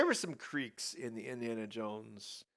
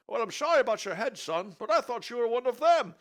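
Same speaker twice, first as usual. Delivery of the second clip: put-on old father voice; 12 semitones higher, 9 dB louder than usual.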